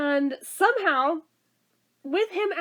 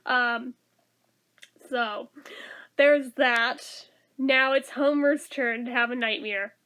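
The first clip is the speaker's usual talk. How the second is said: normal talking voice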